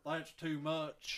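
drawn-out put-on voice; hammy, slow put-on voice; over-the-top voice. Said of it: "that voice is too much".

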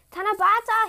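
high, loud call upward; shout. A narrator's reading. Mirekan shouting up to heaven for his wife, loud call. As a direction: call up to the sky. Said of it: "he shouted".

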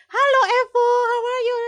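very high, sing-out hello; delighted grandma greeting. Retold or imitated. Imitating a grandma who always hugs her, delighted greeting. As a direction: greet like a grandma going in for a hug.

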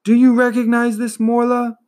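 put-on boy hero holding up the amulet to the turtle, urgent. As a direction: thrust it at her with urgency. Boy challenges the giant turtle.

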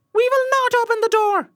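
very high, loud little voices; kids crying out. A narrator's reading. Kids refusing the wolf at the door, high-pitched cry. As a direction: squeal a defiant refusal. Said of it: "cried they".

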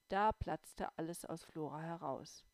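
ordinary delivery, nothing marked

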